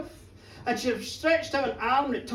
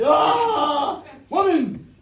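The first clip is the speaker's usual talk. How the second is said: a higher, loud crazed cry; mad yell; 4 semitones higher, 8 dB louder, much slower than usual. Acted. Connor chasing the fleeing swan maidens, a loud crazed yell. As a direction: bellow it like a man gone wild. Connor charging after the women.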